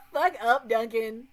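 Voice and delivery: loud, exasperated mock shout; retold scolding. Streamer retelling his reply to whining friend Duncan, loud scolding. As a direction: belt out the retold telling-off.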